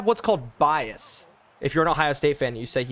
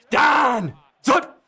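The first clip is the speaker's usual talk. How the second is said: excited shout; 9 semitones higher, 4 dB louder than usual. Real, not acted. Celebrating a big play.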